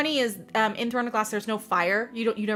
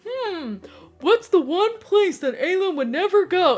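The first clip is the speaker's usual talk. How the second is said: higher, knowing put-on voice for Chaol; sly Chaol; 9 semitones higher than usual. Chaol slyly hinting to Yrene about Aelin, mock knowing. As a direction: tease it like you already know. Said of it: "And Chaol goes".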